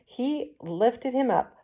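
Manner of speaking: ordinary delivery, nothing marked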